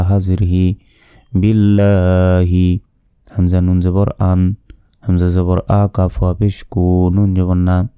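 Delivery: usual speaking voice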